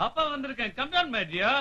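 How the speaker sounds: put-on soft, high-pitched call to his child; hushed singsong endearment; sweet coaxing call. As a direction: coax her down tenderly. Father calls daughter down sweetly.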